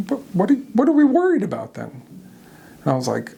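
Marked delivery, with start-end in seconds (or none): 0.1-1.9 worried wife. Retold or imitated. Wife questioning him, worried.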